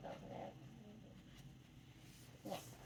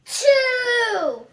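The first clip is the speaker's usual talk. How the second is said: excited shout; 10 semitones higher, 17 dB louder than usual. Acted. Loud shout in story.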